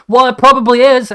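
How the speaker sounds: defiant comeback. Retold or imitated. Retelling his comeback to critics, high mock retort. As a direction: fire back the excuse, mock defiant.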